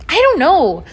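high, loud, exasperated gasp. A narrator's reading. Dwayne squeezing past the two of them, gasping. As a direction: blurt it while squeezing past. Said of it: "Dwayne gasped while trying to squeeze past".

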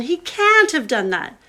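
high, loud, drawn-out cry of disbelief; shocked disbelief. Acted. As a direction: she cannot believe what she hears. Susan shocked at betrayal news.

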